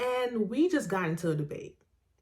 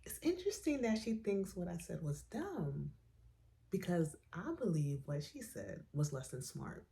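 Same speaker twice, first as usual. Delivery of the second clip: softer, as if thinking it to herself; inner thought, softly; 10 dB quieter than usual. Retold or imitated. Telling the story of her silent reply to her friend, softly. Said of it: "And in my mind, I said".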